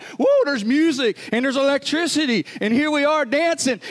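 high, loud, amazed exclamations; thrilled crowd. Retold or imitated. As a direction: imitate villagers delighted by the music. Voicing the thrilled dancing crowd.

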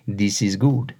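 slow, low, serene reassurance; unhurried serene catchphrase; calm friend. Retold or imitated. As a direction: say it slowly and contentedly.

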